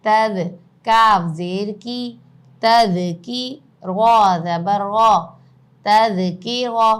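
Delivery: loud, slow letter-by-letter spelling; spelling drill. Shown as a demonstration. Teacher spells the word aloud for the class, slow and loud. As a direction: call out each letter and vowel for students to repeat.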